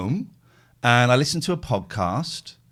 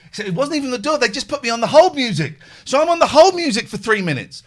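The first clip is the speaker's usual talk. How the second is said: worked up; 10 semitones higher, 7 dB louder than usual. Real, not acted. Still worked up retelling.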